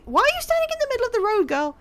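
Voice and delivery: very high, rapid disbelief; incredulous outburst. Real, not acted. Player scolding a girl standing in the road, incredulous. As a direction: burst out in a high, rushed protest.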